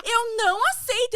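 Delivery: high, loud, firm voicing of mother; defiant mother. Guest's mother refusing the diagnosis, high loud defiant quote. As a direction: refuse the news, loud and resolute. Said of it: "Ela falou assim".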